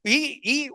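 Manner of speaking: high little imitated baby cry; mock baby whimper. Teacher showing the class how a baby cries, a high mock whimper. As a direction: squeak out a baby's hungry whine, lightly.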